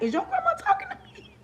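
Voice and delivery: put-on a kid's high, hushed shock that grandma is addressing them; stunned disbelief. Kid asks friend in disbelief, high hushed voice. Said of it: "You looking at your friend like".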